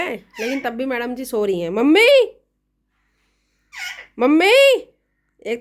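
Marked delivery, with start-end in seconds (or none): 1.7-4.5 loud call of mammi to wake her. Real, not acted. Daughter-in-law calling her sleeping mother-in-law, loud call. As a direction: call her name loudly up the house.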